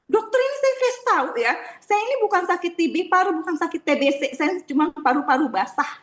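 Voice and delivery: suara tinggi marah-marah menirukan pasien. Retold or imitated. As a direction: tirukan bapak yang marah, nada melengking. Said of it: "dia langsung marah". (menirukan pasien marah, suara tinggi)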